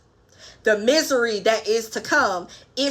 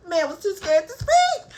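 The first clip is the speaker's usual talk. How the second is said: laughing; 8 semitones higher than usual. Real, not acted. Laughs after quote.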